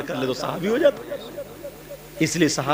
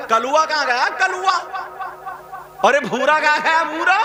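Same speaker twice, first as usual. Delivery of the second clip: raised mock calling for the dogs; 12 semitones higher than usual. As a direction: call out to find the dogs. Imitating husband calling for the dogs.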